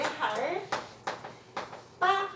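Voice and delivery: 할 빠 with a hard tense ㅃ; tensed pronunciation. Shown as a demonstration. Teacher shows how it is read, tensed 할 빠. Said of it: "이렇게 읽어준다는 거야".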